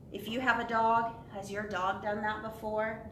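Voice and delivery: usual speaking voice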